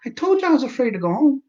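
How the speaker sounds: sarcastic patient venting at the doctor; exasperated rant. Retold or imitated. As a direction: push it up high in disbelief. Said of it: "I'm like".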